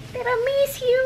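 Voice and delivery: tiramisu, called out high and bright with delight; excited exclaim. Real, not acted. Vlogger announcing the ice cream flavor, excited. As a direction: cry out the flavor happily.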